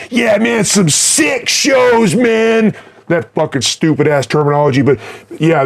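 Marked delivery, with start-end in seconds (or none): 0.1-2.7 high, slow, drawn-out mock of a New Jack kid. Mocking young fans raving about sick shows, drawn out and high. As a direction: sneer at the new fans' stupid slang. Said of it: "like you New Jack motherfuckers".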